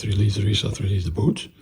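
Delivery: ordinary delivery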